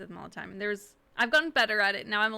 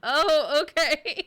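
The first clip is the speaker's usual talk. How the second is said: startled oh okay; 6 semitones higher, 8 dB louder, much slower than usual. Retold or imitated. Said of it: "she's like".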